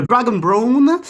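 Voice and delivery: French speaker's rough English accent; shaky attempt at an English accent; bad English accent. Real, not acted. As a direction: say it in clumsy, high English. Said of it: "désolé pour mon accent de merde anglais".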